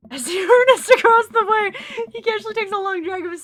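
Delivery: loud laughter through the words; laughing. Real, not acted. Player cracking up at smoking kid, laughing loudly. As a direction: laugh while narrating.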